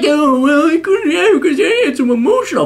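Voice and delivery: very high mock-crying whine; hammed-up blubbering imitation; whiny excuse-maker. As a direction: whimper it in cruel mockery. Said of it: "This is like".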